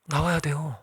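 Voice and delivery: ordinary delivery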